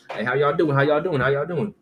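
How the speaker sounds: feigned casual greeting. Retold or imitated. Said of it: "pretending like none of that shit happened".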